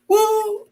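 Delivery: sudden high party whoop. Real, not acted. Recalling a party song, an excited whoop. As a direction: let out a high "woo".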